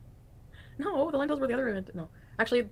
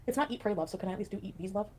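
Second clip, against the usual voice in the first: usual speaking voice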